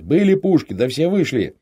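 high, loud explanation; shouting to the deaf. A narrator's reading. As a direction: spell it out loudly for deafened men. Shouting to deafened comrades.

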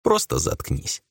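slow, tired grumble; mild grumble. Acted. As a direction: let it go, no anger. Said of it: "беззлобно буркнул Ром".

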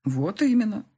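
emphatic, instructive agreement; didactic approval. A narrator's reading. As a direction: make the point like a teaching parent. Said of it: "назидательно кивнул головой отец".

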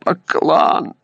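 high, strained voice of a wounded man; dying man's gasp. A narrator's reading. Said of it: "выдохнул раненый". (выдох умирающего)

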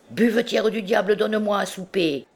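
high-pitched tavern holler for service; rowdy demand. A narrator's reading. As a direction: break off and call out impatiently. Breaks off chant, demands supper.

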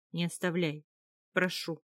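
plain reading voice

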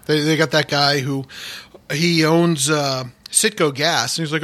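normal talking voice

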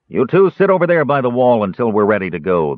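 ordering slaver. A narrator's reading. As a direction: bark it at the prisoners like a slaver. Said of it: "the bearskin man ordered".

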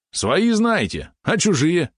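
high, stretched, mocking yap; Валерон yapping. A narrator's reading. Small companion scoffs at the naive lad, yapping. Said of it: "тявкнул Валерон".

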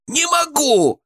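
very high, drawn-out panicked cry; high-pitched chipmunk shriek without much volume; terrified wail. A narrator's reading. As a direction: wail it out in horror. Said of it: "завопил Бурундук, и его голос дрожал от ужаса".

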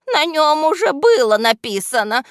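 high, loud little henchman voice; whining excuse. A narrator's reading. Henchman excusing himself to his boss, high defensive whine. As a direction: plead your innocence in a high-pitched voice. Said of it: "оправдывался вреднюга".

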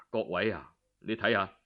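ordinary delivery, nothing marked

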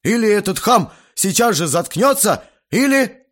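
loud, high threat left hanging; furious ultimatum. A narrator's reading. Guest demands father silence the boy, furious. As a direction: threaten loudly, climbing to the last word.

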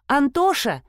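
high, sing-out call; calling from room. A narrator's reading. Wife calls husband from another room, calling. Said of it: "донёсся из комнаты голос Эммы".

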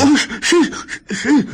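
terrified stammer. Acted.

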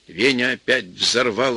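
plain reading voice

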